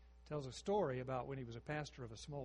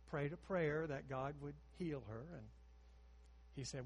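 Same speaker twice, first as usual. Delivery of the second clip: ordinary talk, nothing marked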